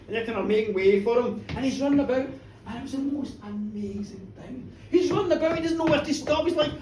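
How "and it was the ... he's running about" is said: plain reading voice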